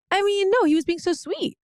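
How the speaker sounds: defending Jeff to her co-host, high and insistent; emphatic protest. Real, not acted. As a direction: jump in to set it straight.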